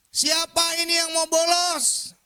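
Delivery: long, high, drawn-out yell; angry teacher's shout. Retold or imitated. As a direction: bellow like a furious teacher catching truants. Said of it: "Guru saya pada waktu marah dia teriak". (teriakan guru marah)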